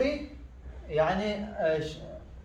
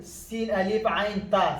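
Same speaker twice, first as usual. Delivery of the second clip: usual speaking voice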